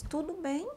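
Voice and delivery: "Tudo bem?" with a rising question tune; "Tudo bem?" asked, pitch climbing on bem; asking tune. Shown as a demonstration. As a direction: lift the end of "Tudo bem?" as a real question.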